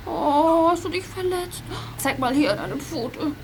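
soft, slow, caring; tender comfort. Acted. Gabi softly comforting Oskar after his paw was stepped on, tender. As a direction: coax the hurt dog gently.

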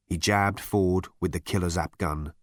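ordinary delivery, nothing marked

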